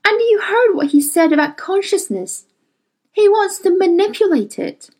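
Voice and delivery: high, indignant protest; heated refusal. Acted. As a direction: refuse in outrage.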